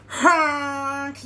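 loud, long truck honk; yelled honk. A narrator's reading. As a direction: blast it like a bossy horn. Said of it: "yelled the dump truck".

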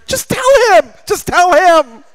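high-pitched coaxing whisper-urge; mimicking a teenage sister urging her sibling.

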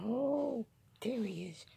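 high excited exclamation. Real, not acted. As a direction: call out eagerly at the cat. Cat reappears.

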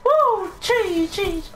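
very high falsetto squeal; excited squeal. Pretend. Gamer cheering at his own play, excited squeal.

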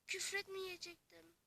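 usual speaking voice

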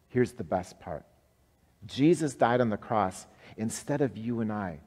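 ordinary talk, nothing marked

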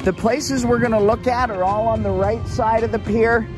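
usual speaking voice

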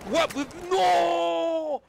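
sudden high cry of no; shocked shout. Real, not acted. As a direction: yell it in disbelief. Grito al morir en partida. (grito de incredulidad)

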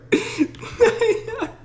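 high, squeaky fearful giggle; shrill nervous giggle. Real, not acted. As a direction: giggle to cover the fear. Nervous after a scare.

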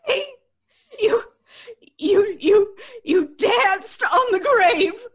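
crying out in hysterical fear; terrified, half-crying outburst; hysterical accusation. Acted. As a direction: sob and shout the accusation. Hysterical girl accusing friend.

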